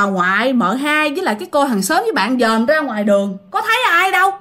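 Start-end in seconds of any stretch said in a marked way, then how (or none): none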